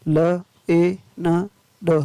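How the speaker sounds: slow, separate phonics sounds; word broken slowly into its sounds; sounding out. Shown as a demonstration. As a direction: say each sound on its own. Phonics teacher sounding out spelling.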